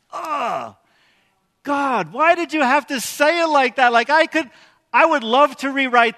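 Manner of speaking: loud, high exasperated plea; exasperated protest. Acted. Preacher protesting to God over a hard verse, exasperated.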